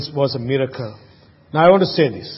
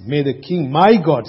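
ordinary delivery

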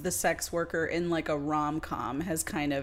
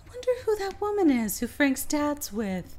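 lightly voiced mother, higher pitch; a hint of a curious mom; 8 semitones higher than usual. Retelling Stacy's mom while shopping, lightly voiced. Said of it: "Stacy's mom says something like".

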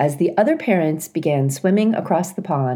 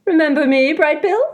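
put-on louder, cheery old goose greeting; old goose Loudwing; 11 semitones higher, 4 dB louder than usual. A narrator's reading. Loudwing waddling into the garden, hearty goose voice. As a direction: hail them like a jolly old goose.

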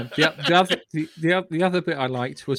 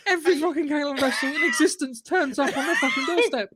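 high, incredulous comic build; laughing exclamation; 9 semitones higher than usual. Real, not acted. Telling friends the wedding gag's climax, laughing exclamation. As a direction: land the absurd climax through laughter.

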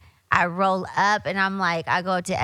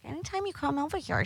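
imitating shop lady; 8 semitones higher, 9 dB quieter, faster than usual. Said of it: "She's like".

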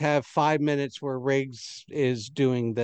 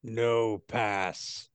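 gruff guard voice; 4 semitones lower, 4 dB quieter than usual. Acted. Door guard refuses entry.

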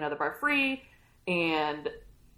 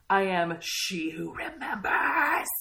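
solemn serpent; slower than usual. Retold or imitated. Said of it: "And she's like".

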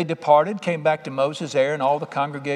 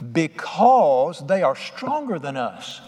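"Because they are stronger than us" dragged very slowly; slow emphasis; much slower than usual. Shown as a demonstration. Preacher quoting the ten spies, slowly stressing each word. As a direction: spell out the excuse word by word. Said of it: "Watch this".

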